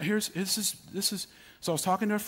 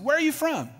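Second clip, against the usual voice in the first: put-on older gentleman asking; 13 semitones higher, 5 dB louder than usual. Voicing older gentleman in story.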